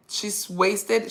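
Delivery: ordinary talk, nothing marked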